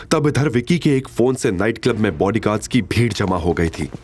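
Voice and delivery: usual speaking voice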